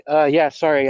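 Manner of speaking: ordinary talk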